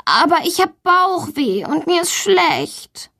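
high, slow, whiny complaint; plaintive insistence. A narrator's reading. Valentina insists to her teacher she is sick, whining. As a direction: insist pitifully that you are poorly. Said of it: "behauptet Valentina".

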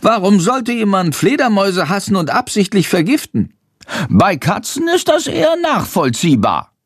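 high little bat voice, indignant; a small bat outraged on behalf of his kind. A narrator's reading. Bat tells Jendrik his outrage, high and indignant.

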